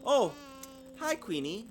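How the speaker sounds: high startled greeting; nervous little girl. Acted. Sister greeting the snooty Queenie, high and uneasy. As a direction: greet her a bit uneasy. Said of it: "said Sister".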